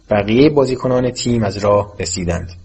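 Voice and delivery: plain reading voice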